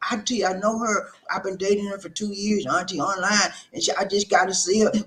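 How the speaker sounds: insistent, pleading nephew. Acted. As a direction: plead your case to a disapproving aunt. Nephew pleads his case to auntie.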